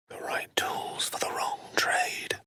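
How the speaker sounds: breathy aside under the breath; whisper. Acted. Wizard murmuring a rueful aside to himself, whispered. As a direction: hush it to yourself as if crying.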